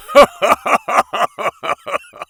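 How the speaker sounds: big booming laugh, pitched high; roar of laughter. A narrator's reading. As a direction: burst out laughing loudly. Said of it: "burst into a roar of laughter".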